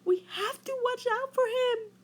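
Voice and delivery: high, frightened fish voice; scared fish. A narrator's reading. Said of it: "whispered the fish".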